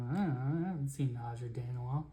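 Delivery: soft, fast mumble; mumbled reply. Acted. Guards shrugging at Felix's question, soft fast mumbling reply.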